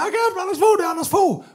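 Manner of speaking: very high excited call; excited waving shout. Retold or imitated. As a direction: call out to him like a fan. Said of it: "vinker og siger".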